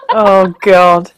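normal talking voice

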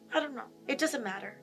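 very soft, dejected shrug of an answer; withdrawn, hushed reply; speaking from sadness. Shown as a demonstration. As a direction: let the voice sink away sadly. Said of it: "'cause we're".

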